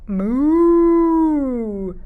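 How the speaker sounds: long high drawn-out moo; cow moo. Shown as a demonstration. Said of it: "What does the vaca say?".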